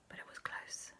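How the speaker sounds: barely audible whisper; confiding aside. A narrator's reading. Storyteller confides aunt was nearly sick, tiny whisper. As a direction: whisper the admission.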